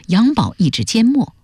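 ordinary delivery, nothing marked

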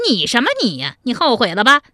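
high-pitched mocking villain; gloating, sing-song scorn; jeering taunt. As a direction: taunt the captive, pitch well up. Villain jeers at the speechless captive.

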